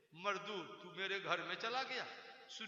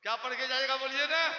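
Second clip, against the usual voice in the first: क्या पढ़ के जाइएगा asked louder and insistent; pressing question; 5 semitones higher, 6 dB louder than usual. Shown as a demonstration. Preacher demanding the crowd answer him, louder.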